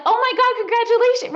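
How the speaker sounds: high, loud gushing congratulations; excited squeal. Retold or imitated. Friends thrilled at her news, squealing congratulations.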